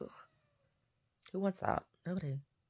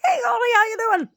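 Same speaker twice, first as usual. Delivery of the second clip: much higher, louder, squealing hello; excited dog greeting; 12 dB louder than usual. Retold or imitated. Greeting Goldie the dog, squealy excited voice. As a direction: gush at the little dog.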